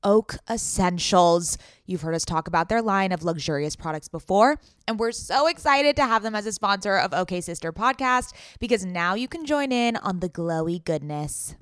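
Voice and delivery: smooth sponsor read voice. Acted. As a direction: read it like a polished ad.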